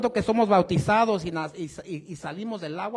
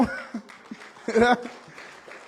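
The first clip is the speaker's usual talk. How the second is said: laugh; 5 semitones higher than usual. Real, not acted. Laughs after mock gossip.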